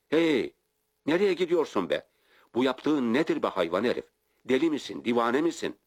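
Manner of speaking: high, loud outraged shouting; outraged man yelling at the driver who leaves him; angry yelling. A narrator's reading. Official left alone in the swamp, angry yelling.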